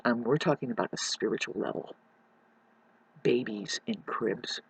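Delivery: ordinary delivery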